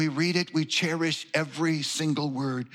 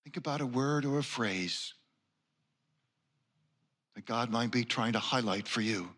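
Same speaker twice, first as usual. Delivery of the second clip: guided meditation; 4 semitones lower, 6 dB quieter than usual. Acted. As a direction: softly invite them to close their eyes. Returns to the meditation prompt.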